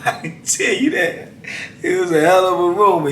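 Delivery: chuckling through the words; laughing. Real, not acted. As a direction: let a real laugh carry the line. Laughing while telling the punchline.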